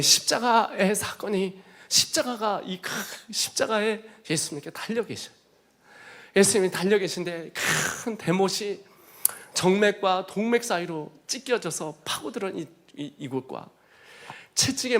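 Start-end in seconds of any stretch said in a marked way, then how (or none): none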